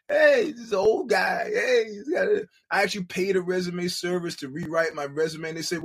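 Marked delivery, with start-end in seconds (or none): 0.1-2.5 mock desperate plea. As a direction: whine like a desperate old job seeker. Mock begging while job hunting.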